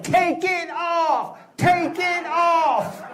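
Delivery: drawn-out high mock yell; imitated crowd yell. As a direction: yell it high, stretching each word like a heckling crowd. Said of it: "They just kept yelling".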